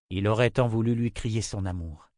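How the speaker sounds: ordinary delivery, nothing marked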